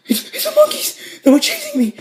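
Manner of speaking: very high retold panic; high-pitched frightened friend, acted out; panicked stammer. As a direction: squeal it in mock terror. Friend terrified on seeing police.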